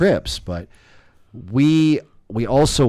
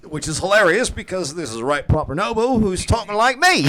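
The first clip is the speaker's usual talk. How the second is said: higher, amused Cockney lilt; playful Cockney accent, pitched up; 12 semitones higher than usual. Retold or imitated. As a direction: slip into Cockney while laughing about it. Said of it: "doing the Cockney accent".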